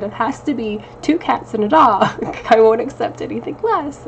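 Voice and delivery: a hint of a tearful wife, insisting half laughing; lightly voiced teary ultimatum to her husband; tearful insistence. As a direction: replay her own teary demand, lightly. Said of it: "and I said".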